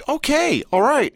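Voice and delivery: high-pitched "well, okay then" reaction; surprised acceptance. Retold or imitated. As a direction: concede it in a high voice. Retelling own reaction on watching.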